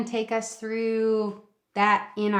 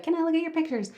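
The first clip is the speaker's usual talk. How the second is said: put-on higher-pitched child's request; raised-pitch quote of a daughter; imitated daughter; 6 semitones higher, 3 dB quieter, much faster than usual. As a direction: lightly voice a child asking. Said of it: "my daughter loves to".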